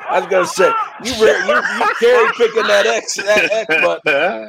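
laughing, half-shouted ribbing; laughing tease. Real, not acted. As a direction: crack up while teasing him about the button. Laughs while teasing host.

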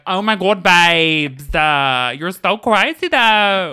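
loud, slow camp gush; phone assistant gushing after a settings change; gushing diva. Pretend. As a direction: fawn over the user. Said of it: "if they change it to British".

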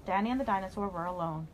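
plain reading voice

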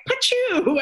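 a very high "Pachu" blast sound; laser zap noise. Retold or imitated. Mocking sensational ghost shows with a high laser zap. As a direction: spit out a cartoon laser shot. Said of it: "as Mike Birbiglia says".

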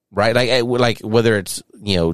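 usual speaking voice

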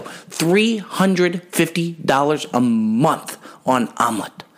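three hundred fifty dollars a month on omelet drawn out slowly; slow, stunned repeat of the price; slow, spelled-out disbelief. Shown as a demonstration. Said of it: "Seriously, though".

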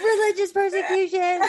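high laughing exclamation. Real, not acted. Bursts out laughing on joke.

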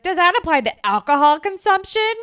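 loud, high, pointed jab of a question; snarky. Retold or imitated. Ellie, tipsy on wine, jabs Caitlyn on stage, snarky and loud. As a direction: needle her with tipsy confidence. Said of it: "like, snarky and".

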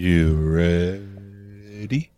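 slow, swirling mic voice; playing with the mic. Real, not acted. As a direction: voice moved around the microphone. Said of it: "I'm having too much fun with this microphone".

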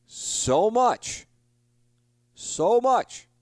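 high, loud wail of frustration; anguished cry. Real, not acted. Host reliving the lost interview to listeners, anguished high cry. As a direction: cry it out like it still hurts.

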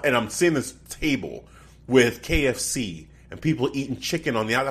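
ordinary talk, nothing marked

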